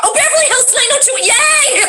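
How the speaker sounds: high squealed fan enthusiasm; over-the-top high-pitched cheer; mock excitement. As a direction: squeal it way up high like thrilled viewers. Mimics late-arriving excited TV viewers.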